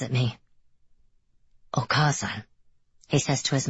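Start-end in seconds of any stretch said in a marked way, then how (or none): 1.7-2.4 whispering. Acted. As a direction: breathe the word Okasan, no voice. Hideo introduces Amika to mother.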